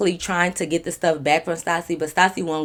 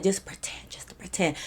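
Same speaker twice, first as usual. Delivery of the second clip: whisper; 9 dB quieter than usual. Retold or imitated. Whispering aside to the girl.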